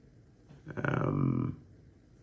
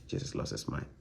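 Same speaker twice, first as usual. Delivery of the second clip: softer, hushed scoffing quote; hushed mock disbelief; mocking whisper; 8 semitones lower, 10 dB quieter than usual. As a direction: whisper their claim with disbelief. Mocking what people claim.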